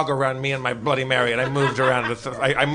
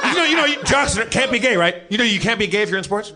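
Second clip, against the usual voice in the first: bartender voice; 5 semitones higher, 4 dB louder than usual. Retold or imitated. Bartender quoted in a story.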